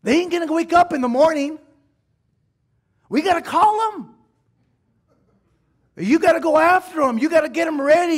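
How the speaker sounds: high, loud fiery sermon call; rally the church to go after the lost; full-force preaching. Acted. Pastor urging the congregation, preaching at full force.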